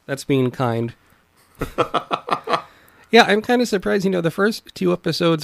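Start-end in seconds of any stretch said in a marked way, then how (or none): 1.6-2.6 a quick airy laugh; breathy laugh. Real, not acted. Laughs at co-host's remark.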